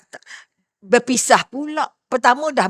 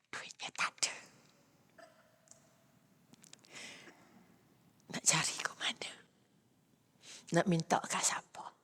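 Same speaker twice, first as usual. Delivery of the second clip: much softer whispered worry; despairing whisper; 4 semitones lower, 15 dB quieter than usual. Retold or imitated. Woman with no money asking herself, hushed whisper. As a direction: whisper her helpless worries.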